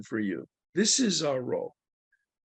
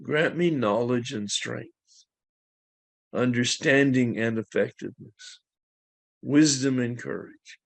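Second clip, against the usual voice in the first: spoken prayer. Acted. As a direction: pray it aloud for the room. Leads the group in prayer.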